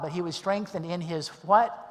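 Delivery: ordinary talk, nothing marked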